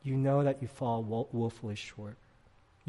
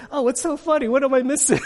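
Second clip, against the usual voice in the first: put-on high, loud innocent kid voice; son asking innocently; 13 semitones higher, 12 dB louder, faster than usual. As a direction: play the puzzled boy asking what's funny. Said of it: "he goes".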